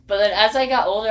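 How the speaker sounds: ordinary delivery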